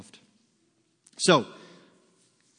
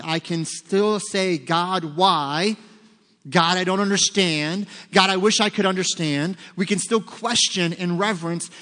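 raised, higher voice, crying out to God; impassioned questioning; 4 semitones higher, 4 dB louder than usual. Acted. Preacher voicing a believer questioning God in the storm, impassioned. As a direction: plead earnestly with God for understanding.

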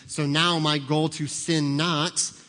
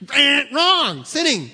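loud wrong-buzzer call; 10 semitones higher, 8 dB louder, slower than usual. Acted. Buzzer-style verdict.

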